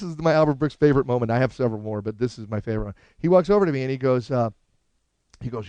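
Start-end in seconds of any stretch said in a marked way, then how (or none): none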